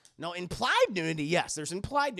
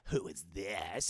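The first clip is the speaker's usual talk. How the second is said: very low, slow, softer villain voice; mock menacing caller; 13 semitones lower, 7 dB quieter, slower than usual. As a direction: drop deep and slow like a phone stalker. Voicing the killer caller.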